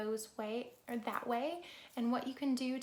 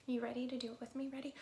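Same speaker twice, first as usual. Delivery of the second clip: ordinary delivery, nothing marked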